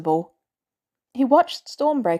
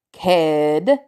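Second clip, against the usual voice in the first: put-on louder, lower call to the kid; calling out as Stark; 4 semitones lower, 5 dB louder than usual. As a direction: call across the battlefield as Stark. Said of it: "Stark called".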